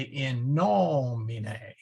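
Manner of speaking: "nomine" drawn out as NO-mi-ne; third-last stress. Shown as a demonstration. Teacher quoting the Our Father, first-syllable stress. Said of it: "go to the third syllable from the end".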